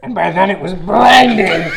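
slow, menacing movie villain growl through a mask; Bane impression. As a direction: drag each line out like a masked villain threatening Batman. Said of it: "That's the Bane impression".

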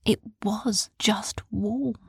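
slow hushed whisper. A narrator's reading. Narrator tells the child listener, whispering slowly. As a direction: breathe it out in wonder.

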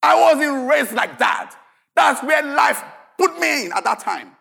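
loud, high preaching at full force; preaching shout. Acted. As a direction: proclaim it to the congregation.

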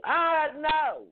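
loud sassy no. Real, not acted. Host shutting down listeners' hygiene excuse, loud and indignant. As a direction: shut it down, outraged and loud.

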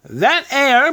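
high-pitched flustered interjection; landlady breaking out. A narrator's reading. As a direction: burst in with a high voice. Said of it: "broke out landlady".

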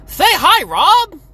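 high announcer voice, drawn out; ringmaster. Acted. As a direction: ham up the host's intro. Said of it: "it's my anime voice".

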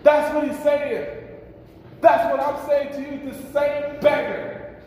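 fervent preaching. Acted. Preacher warning the congregation about people in hell, fervent. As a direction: press the warning home.

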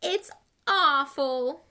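high, loud, stretched-out delight; gleeful. A narrator's reading. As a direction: savour the bad news slowly. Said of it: "never seen her looking so happy".

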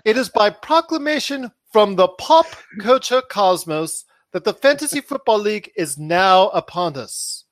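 grand, slow herald proclamation; old English town crier. Pretend. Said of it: "In the old English style".

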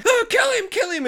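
very high squealed cry; imitated high-pitched kid shriek; shrieking mock kids. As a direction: squeal it like a mob of children. Acting the upset kids turning on him.

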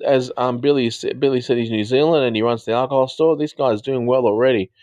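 normal talking voice

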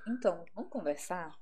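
low, hushed, controlled invitation to talk. Acted. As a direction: drop pitch and volume, ominously calm. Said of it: "quando eu baixo o tom".

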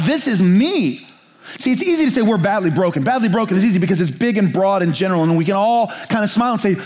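rising, pleading preacher's build; high, pleading sermon delivery; impassioned preaching. Acted. Preaching about bringing sin to God.

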